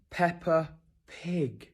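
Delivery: slow, drawn-out disbelief; incredulous echo. Real, not acted. Brit repeating what the little girl called him, stunned and slow. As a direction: repeat it slowly, as if you can't believe it. Echoing the girl's remark in disbelief.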